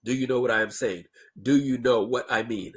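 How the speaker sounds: "Do you know what I am saying" fully enunciated; "Do you know what I mean" with no slurring; full careful words. Shown as a demonstration. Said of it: "two and a half syllables".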